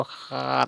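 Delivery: हाथ begun with a rough kh-h sound, as khaat; long breath of kh into हाथ; Assamese kh pronunciation. Shown as a demonstration. Teacher showing Assamese seven, kh-h instead of s. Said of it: "उच्चारण करते time में".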